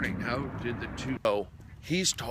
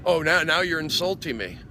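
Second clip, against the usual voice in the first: louder, higher, offended reply; indignant retort; 6 semitones higher, 6 dB louder than usual. Retold or imitated. The rocker guy answering the comedian, mock offended and loud. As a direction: play the heckler taking offence, half acted.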